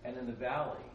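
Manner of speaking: normal talking voice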